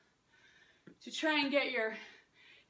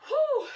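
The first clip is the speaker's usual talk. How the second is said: big breathy whew after the sprint; winded exhale; 11 semitones higher, 7 dB louder than usual. Real, not acted. Coach to viewers, out of breath after intervals, loud whew. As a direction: blow out hard, spent from the workout. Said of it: "I'm out of breath".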